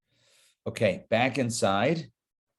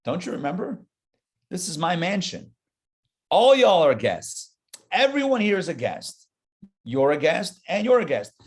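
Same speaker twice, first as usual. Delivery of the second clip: God scolding; 5 semitones higher, 4 dB louder than usual. Retold or imitated. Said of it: "God says".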